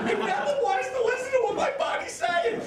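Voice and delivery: very high, shouted imitation; high, hammed-up scolding; mock angry complaint. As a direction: wail the complaint at the top of your range. Imitates girlfriend's show, shouted complaint.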